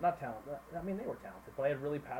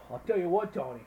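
louder, higher put-on coach voice; Tony's coach voice; 5 semitones higher, 7 dB louder than usual. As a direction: imitate a worked-up football coach calling out a player. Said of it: "that was Tony's voice about every coach".

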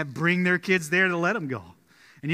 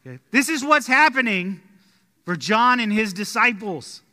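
ordinary talk, nothing marked

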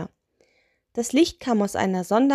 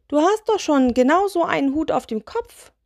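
higher, exasperated objection; incredulous; 9 semitones higher, 4 dB louder than usual. Acted. Spirit objects to a hat wish, incredulous. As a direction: protest with pitch jumping up.

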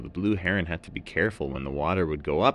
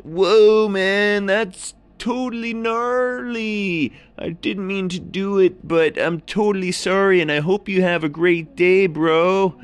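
surfer seal; 13 semitones higher, 8 dB louder than usual. A narrator's reading. Seal apologising to the heron, high loud surfer voice. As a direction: gush it like a chill surfer bro. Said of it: "And the seal said".

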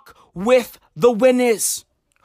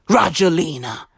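ordinary delivery

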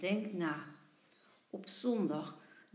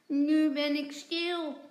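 loud, higher, stuffed-mouth speech; mouth full; 5 semitones higher, 6 dB louder than usual. A narrator's reading. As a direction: repeat it slowly through a mouthful. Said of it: "met volle mond zegt hij".

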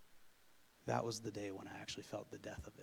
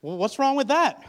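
much higher, louder mock mother voice; mom's question; 13 semitones higher, 18 dB louder than usual. Mom to son pacing, high loud mock-mother question. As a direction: play the amused mom asking. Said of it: "She's like".

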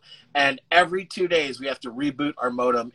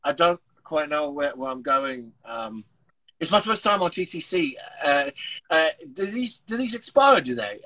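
put-on British accent of a confused visitor; 2 semitones higher than usual. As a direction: play lost in a British accent. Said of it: "I started speaking in a British accent".

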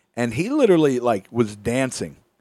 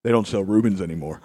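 dead serious deadpan, lower; super serious; 7 semitones lower, faster than usual. Retold or imitated. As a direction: deliver it like grave news. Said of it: "No greeting. Super serious".

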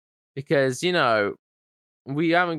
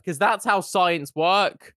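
usual speaking voice

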